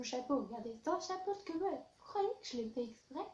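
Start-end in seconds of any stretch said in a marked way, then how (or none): none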